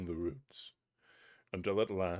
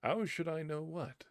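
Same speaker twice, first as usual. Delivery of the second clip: put-on higher, bold boyish reply; fearless boy; 8 semitones higher than usual. Boy answering the spirit fearlessly, higher voice. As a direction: answer the giant without fear. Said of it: "replied the boy fearlessly".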